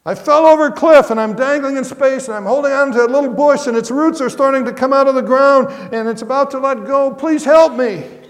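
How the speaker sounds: high, loud panicked rush of words; frantic pleading. Acted. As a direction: blurt it out, clinging to a bush. Dangling tourist begs for help.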